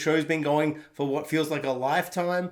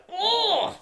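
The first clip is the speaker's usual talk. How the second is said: high, drawn-out mock "oh"; choked-up moan. Fan retelling a heartbreaking scene, choked-up high moan. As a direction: wail it briefly, as if fighting back tears. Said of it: "Fighting back the tears".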